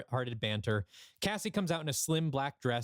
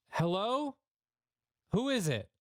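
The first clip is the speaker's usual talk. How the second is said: high call through a closed door; 9 semitones higher than usual. Acted. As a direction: call out to whoever is knocking. Luke calls through the door.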